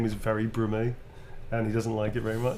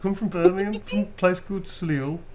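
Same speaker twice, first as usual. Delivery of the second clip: broad, lifted Brummie; original Birmingham accent; 5 semitones higher than usual. Shown as a demonstration. Showing Blue the original accent, broad Brummie. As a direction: talk like a Birmingham local. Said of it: "do you wanna hear my original accent".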